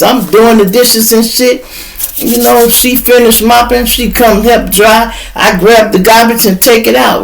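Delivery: ordinary talk, nothing marked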